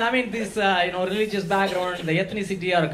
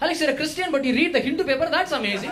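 impressed fan voice; 8 semitones higher than usual. Retold or imitated. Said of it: "People go like".

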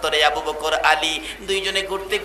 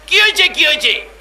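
high, urgent repeated question; acted panic of someone jolted awake by screams; alarmed questioning; 8 semitones higher, 5 dB louder, faster than usual. Husband woken by wife's scream. (উঁচু গলায় আতঙ্কিত জিজ্ঞাসা)

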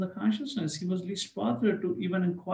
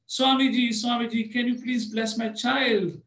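pleading father; 7 semitones higher, slower than usual. Retold or imitated. Father on the train begs Prabhupada, eager pleading. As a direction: beg the swami to bless your child.

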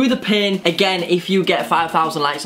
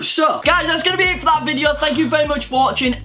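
vlog outro; 10 semitones higher than usual. Acted.

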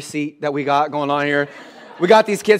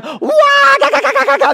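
high mock shrieking like wild kids; imitating wailing children at bedtime; banshee kid shrieks; 5 dB louder than usual. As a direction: screech like a kid gone feral at bedtime. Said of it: "they turned into banshee mode".

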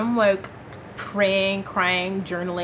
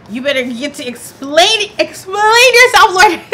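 much higher and louder, slowing on explain yourself; mock-outraged shriek; sassy loud demand; 10 semitones higher, 12 dB louder than usual. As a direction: scold the Lord, half joking. Demanding God explain himself.

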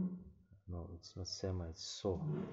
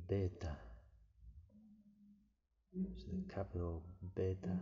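usual speaking voice

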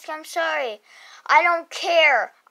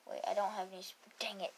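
normal talking voice

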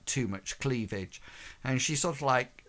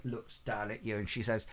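ordinary talk

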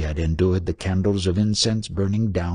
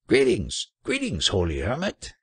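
high-pitched hail without real volume; mock bellow; 6 semitones higher than usual. A narrator's reading. Trader hailing the holy hermit, restrained bellow. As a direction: call out high, as if to someone far off. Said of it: "he bellowed, as if shouting to one a mile away".

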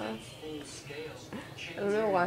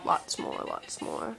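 fading whisper; 2 semitones higher, 9 dB quieter than usual. Real, not acted. Fading repeated "lots more.

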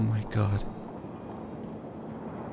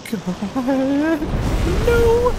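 high shrieked no; panicked scream. Real, not acted. Caught by the monster.

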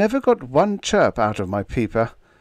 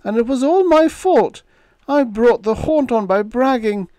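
high, wailing self-blame; loud, high miserable wail; 6 dB louder than usual. A narrator's reading. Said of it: "Todd wailed miserably".

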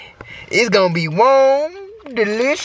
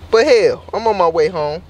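ordinary delivery

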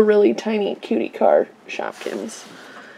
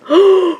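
surprised gasp; 7 semitones higher, 4 dB louder than usual. Real, not acted. Reaction to finding unicorn.

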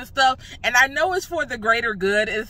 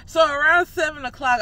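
normal talking voice

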